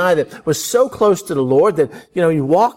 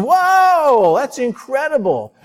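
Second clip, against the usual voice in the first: mock amazement; 10 semitones higher, slower than usual. Preacher mocking readers wowed by Abraham, hammed-up amazement.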